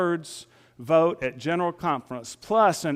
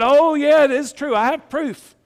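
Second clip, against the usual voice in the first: higher and louder, mock cocky know-it-all; smug insistence; 10 semitones higher, 8 dB louder than usual. Pastor imitating an online arguer insisting he has proof, cocky and smug. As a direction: play the online arguer sure he's right.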